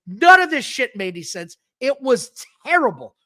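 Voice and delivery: ordinary talk, nothing marked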